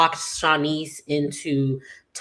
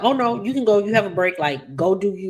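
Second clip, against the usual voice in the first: high, pushy encouragement; oh no you can go, go do you; eager urging; 5 semitones higher, faster than usual. Retold or imitated. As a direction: egg her on, bright and insistent. Friend urging her to go.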